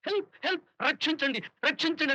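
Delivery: high-pitched pretend victim calling out; mock cries for help. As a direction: imitate someone crying for rescue, without real volume. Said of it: "అని పెద్దగా కేకలేస్తాం".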